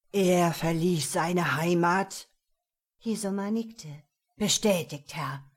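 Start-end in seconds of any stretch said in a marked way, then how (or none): 0.1-2.3 louder, slow, menacing demon; underworld god voice. A narrator's reading. Devil questions mage. 4.4-5.4 dark mage voice. A narrator's reading. Mage answers master.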